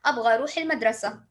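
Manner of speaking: fast, casual Saudi Arabic; quick colloquial Saudi Arabic; Saudi dialect. Shown as a demonstration. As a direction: toss it off in everyday Saudi dialect. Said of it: "My accent is Saudi, then I will talk like this".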